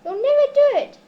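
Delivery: loud emphatic exclamation; hammed-up loud warning; exclaiming. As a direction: blurt it out, aghast. Neddy exclaims at the plan.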